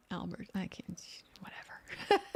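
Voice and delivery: breathy giving-up whisper; muttered under the breath, trailing off; whispered aside. Real, not acted. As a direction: drop to a whisper and give up. Gives up on the name, aside.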